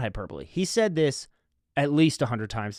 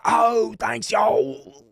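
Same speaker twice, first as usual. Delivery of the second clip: mock Southern thanks, higher and louder; Southern drawl; 8 semitones higher, 4 dB louder, much slower than usual. Drunk Alabama fan thanking the narrator, Southern drawl. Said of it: "In the way that Southern people like to talk".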